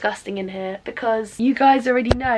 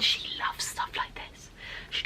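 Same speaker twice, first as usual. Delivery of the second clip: whisper; 13 dB quieter than usual. Real, not acted. Aside about her mum's habits.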